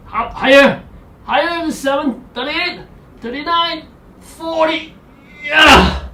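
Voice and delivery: straining. Real, not acted. Coach holding the Iron Man pose, high strained count. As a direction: push the pitch up under effort.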